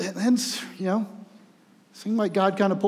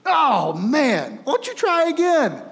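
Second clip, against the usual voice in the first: much higher, loud sneering encouragement; mocking villain; 11 semitones higher, 5 dB louder, slower than usual. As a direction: fake pity from a wrestling heel. Villain mocks the boy again.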